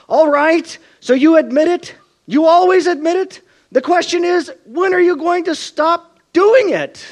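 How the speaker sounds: a fed-up dad losing patience with his son; exasperated father. Retold or imitated. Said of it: "who is just exasperated".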